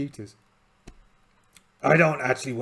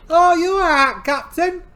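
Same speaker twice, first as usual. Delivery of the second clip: worried crewman voice; 9 dB louder than usual. Acted. Crewman asks injured captain.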